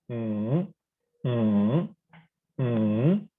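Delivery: "mm" sinking low, then rising; deep tone. Shown as a demonstration. Teacher reviewing the third one, deep tone. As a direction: scoop "mm" down and up. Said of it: "deep tone".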